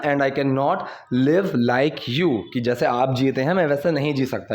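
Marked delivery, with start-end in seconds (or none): 0.0-2.4 very slow, word by word for learners; slow model reading. Shown as a demonstration. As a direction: spell it out slowly like a teacher. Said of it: "teacher models English sentence". (बहुत धीमे, शब्द-दर-शब्द पढ़ाते हुए)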